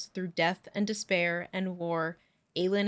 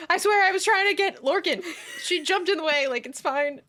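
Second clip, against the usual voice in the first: defensive excuse; 10 semitones higher, 6 dB louder, faster than usual. Retold or imitated. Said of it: "Fenris is like".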